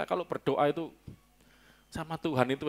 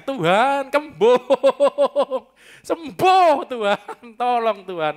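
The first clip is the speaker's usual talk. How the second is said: loud, high, drawn-out mock wail; hammed-up cry for healing; 8 semitones higher, 9 dB louder, much slower than usual. Preacher acting a bellyache prayer to God, hammed-up crying out. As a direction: wail to God about a bloated belly, comically stretched. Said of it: "Ya buntung perutnya pegang".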